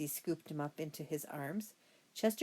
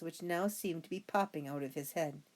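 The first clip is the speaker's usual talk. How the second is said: ordinary delivery, nothing marked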